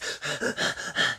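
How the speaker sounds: breathless frightened gasps; panting gasps. Shown as a demonstration. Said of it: "panting means".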